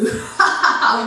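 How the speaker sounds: half-hearted imitation of a manic laugh; mad laugh. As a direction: laugh like you've lost it. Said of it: "정말 제 흉내를 못 내요".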